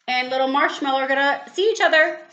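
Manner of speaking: excited. Real, not acted. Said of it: "Uh-oh".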